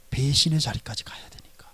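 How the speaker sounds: soft whispered punchline; preacher's whisper; hushed whisper. Acted. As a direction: whisper it like a secret revealed. Sermon point, betrayal.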